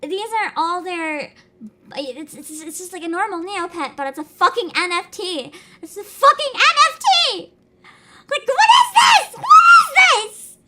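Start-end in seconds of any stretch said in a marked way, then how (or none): 5.8-10.6 furious shout, much higher and louder; real angry scream. Real, not acted. Streamer screaming at the NFT page in fury. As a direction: scream it out in outrage. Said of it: "I needed to scream really hard".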